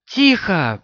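sharp, commanding hush. A narrator's reading. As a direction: order silence sharply. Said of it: "приказала Морейн".

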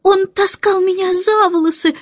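high, lightly voiced sobbing girl; a hint of a tearful girl hiding her face; girl complaining to the nanny, a touch tearful. A narrator's reading. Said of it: "всхлипывала Джейн".

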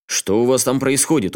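usual speaking voice

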